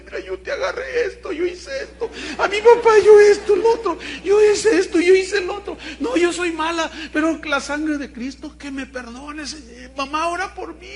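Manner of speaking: put-on very high, loud child's wail; imitated tearful confession. Daughter confessing her sins to mother, loud hammed-up crying. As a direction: bawl it out like a distraught girl.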